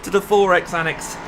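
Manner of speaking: shouting over engine. Real, not acted. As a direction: project your voice over a roaring engine. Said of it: "hear me over the engine noise".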